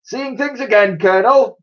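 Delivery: high-pitched loud call. A narrator's reading. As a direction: call out to someone across the way. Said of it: "she called out".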